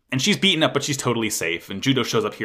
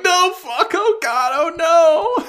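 very high, louder mock-panicked cry; horrified wail; 6 dB louder than usual. Fan watching the Mark two turn on, mock horror. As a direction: wail in hammed-up dread. Retold horrified reaction to mech.

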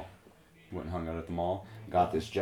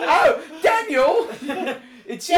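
much higher, laughing shriek; shrieking laughter; 9 dB louder, much slower than usual. Pretend. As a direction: squeal it out while laughing. Mock reveal, shrieking laughter.

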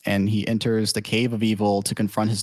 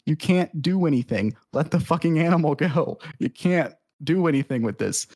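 higher-pitched mock scolding; exasperated pleading; 5 semitones higher than usual. Uncle urging his nephew to free the animal, exasperated. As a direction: plead in exasperation, as an acted quote.

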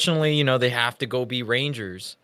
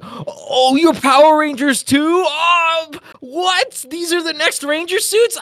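excited surprise; 13 semitones higher, 5 dB louder, slower than usual. Retold or imitated. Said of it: "And you're like".

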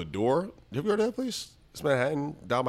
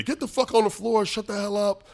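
higher-pitched mock police order; police command; 8 semitones higher than usual. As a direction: act out an armed cop taking control. Acting out police storming in.

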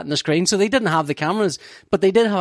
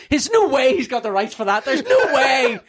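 laughing indignation; 7 semitones higher than usual. Retold or imitated. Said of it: "It's going".

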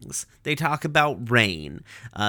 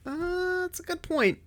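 very high, soft conceding murmur; Garnet; 5 dB quieter than usual. Retold or imitated. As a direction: give in softly, pitched way up. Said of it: "And Garnet's like".

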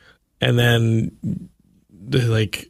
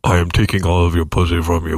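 God voice; 6 semitones lower than usual. Pretend. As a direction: lower voice of God, laughing. God speaking.

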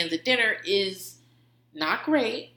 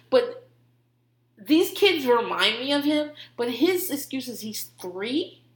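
usual speaking voice

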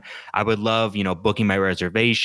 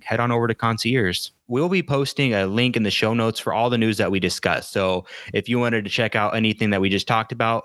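ordinary talk

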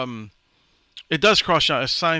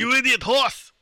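Schwarzenegger voice; 9 semitones higher than usual. Pretend. Arnold voice scolding horse.

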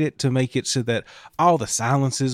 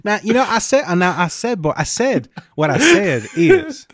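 Foghorn Leghorn; 7 semitones higher than usual. Acted. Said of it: "Foghorn Leghorn's out there".

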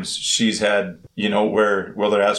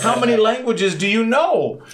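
amazed; 11 semitones higher than usual. Retold or imitated. Amazed at the multilingual vendor.

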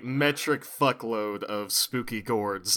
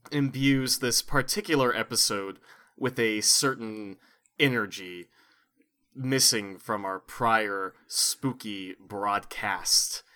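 usual speaking voice

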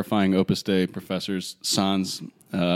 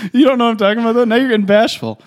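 teasing; 12 semitones higher, 6 dB louder, faster than usual. Retold or imitated. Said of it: "I was like".

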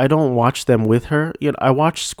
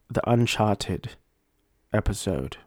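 softer mock-British accent, R dropped in Uncharted; put-on British accent; 2 semitones lower, 5 dB quieter than usual. Host correcting himself on the episode title, in a put-on British accent. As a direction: slip into an attempted British accent. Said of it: "I will be speaking in a British accent".

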